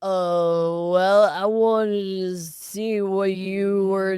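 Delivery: very slow, loud, slurred Californians-Cajun impression; slow-motion Californians-Cajun drawl. Date explaining his phone call, slow-motion Californians-Cajun drawl. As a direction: drag and slur it like a Californians-Cajun mock accent. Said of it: "Was his phone on slow motion?".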